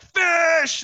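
high, loud yell down the hall; yelling for Fish. Retold or imitated. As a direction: bellow for the freshmen. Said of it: "they start yelling for Fish".

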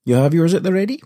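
normal talking voice

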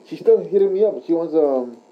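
usual speaking voice

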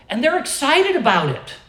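loud, high, fervent sermon climax; preaching at full force. Acted. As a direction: drive it home from the pulpit.

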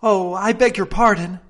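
high, loud, flustered apology; great dismay. A narrator's reading. Alice apologising for upsetting the jurymen, dismayed. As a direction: exclaim it in dismay. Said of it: "in a tone of great dismay".

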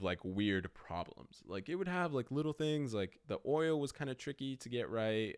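usual speaking voice

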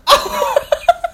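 high, loud breathy giggle fit; burst of laughter. Real, not acted. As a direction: crack up helplessly. Laughing again after cut.

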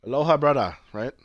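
put-on a light Jamaican accent on a Hawaiian greeting; mock Jamaican islander greeting; hint of a Jamaican accent. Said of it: "That was Jamaican".

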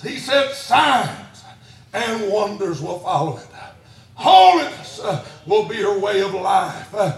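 slow, punched preacher's phrases; forceful preaching. Real, not acted. Preacher declaring the church marked by fire, forceful preaching. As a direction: hurl out each phrase, then pause.

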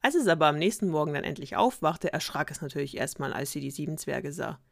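ordinary delivery

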